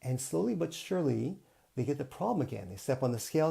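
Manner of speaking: ordinary talk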